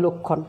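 লক্ষণ with rounded o, "lok-khon"; first vowel of লক্ষণ closed to o; wrong closed o. Shown as a demonstration. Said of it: "বলছি না".

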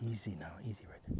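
soft, breathy calm to the dog; soothing hush. Real, not acted. Trainer calming her retriever, hushed soothing. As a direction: hush the dog steady, almost whispered.

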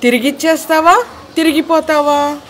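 magic coin yelling at the mother; coin shouting. A narrator's reading. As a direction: call it out like a scolding magic coin. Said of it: "నాణెం మళ్ళీ అరుస్తోంది".

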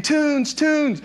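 high, drawn-out mock toddler holler; imitated toddler yell. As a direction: mimic a small boy yelling for cartoons. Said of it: "Bronson's yelling".

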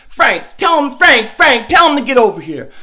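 loud call across the room. Acted. Host calling his buddy over, loud call.

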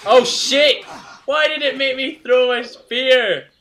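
loud, high-pitched outburst; real exasperated shout; frustrated yell. Real, not acted. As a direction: yell in dismay at your own blunder. Mishap in the game.

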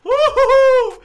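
stadium-level celebration whoop; excited cheer. Real, not acted. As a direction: whoop for joy at the top of your voice. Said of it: "I'm so excited".

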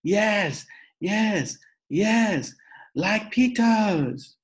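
high, loud, drawn-out yes; hollering toddler. A narrator's reading. Little brother demanding shoes like his big brother, hollering. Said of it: "Fudge hollered".

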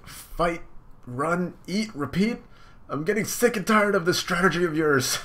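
high, loud outraged villain; tired Cracker scolding Luffy, high. Acted. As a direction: rage at him, high. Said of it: "He's like".

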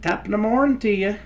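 put-on high, fast Irish accent. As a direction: chirp it in a stage Irish accent. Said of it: "I could be Irish".

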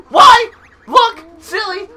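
high, loud cartoon exclaiming; peppy character. Peppy tutor to a silly pupil, high and loud. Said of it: "a little bit more peppy".

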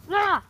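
loud high scream. Real, not acted. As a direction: let out a sudden scream. Countdown then scream.

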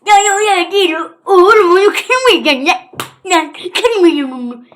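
loud, high mock-upset outburst; hammed-up loud pleading; wailing protest. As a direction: wail it out in protest. Mock upset outburst about breaking.

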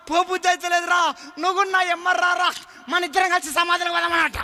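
put-on high, pleading old father calling his son; dying father pleading. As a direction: beg the son to come along to the grave.

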